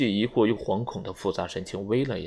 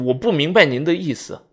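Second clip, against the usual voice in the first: higher and louder, piercing; shrill; 7 semitones higher, 6 dB louder than usual. A narrator's reading. Vera rising and crying out sharply at the general. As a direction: cry it out sharply. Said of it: "尖声地说".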